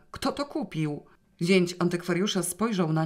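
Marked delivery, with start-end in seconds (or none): 0.1-1.0 higher, urgent menacing demand; terrible voice. A narrator's reading. Justyna demands to know the buyer, higher and urgent. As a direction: press him hard for the buyer's name. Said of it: "przerwała Justyna strasznym głosem".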